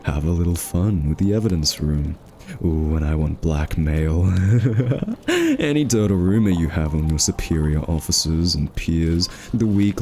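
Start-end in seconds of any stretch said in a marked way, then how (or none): 4.3-5.2 gloating chuckle. Acted. Villain gloats over blackmail.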